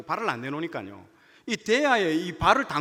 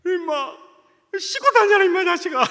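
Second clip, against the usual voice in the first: much higher, louder mock-tough yell; gruff teasing shout; 12 semitones higher, 9 dB louder than usual. Teacher embarrassedly telling the student to wash, loud gruff shout. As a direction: cover the awkwardness with a loud tease.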